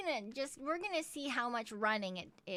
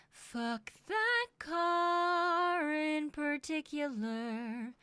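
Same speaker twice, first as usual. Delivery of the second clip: slow, higher, drawn-out grudge; mock bitter; 5 semitones higher, much slower than usual. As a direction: curse the car with dramatic slowness. Bitter mock outburst at car.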